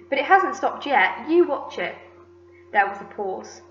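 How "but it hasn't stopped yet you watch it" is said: raised, louder retort; argumentative. A narrator's reading. Aunt contradicting her sister, argumentative. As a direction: argue back with a warning. Aunts bicker over the peach.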